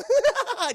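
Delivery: high, loud gloating villain cackle; evil laugh. Retold or imitated. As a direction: throw your head back and cackle. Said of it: "Just laughing and shit".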